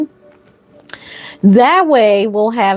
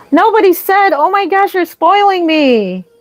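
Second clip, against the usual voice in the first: spoiled and thrilled; excited squeal; 8 semitones higher than usual. Real, not acted. Yet another donation.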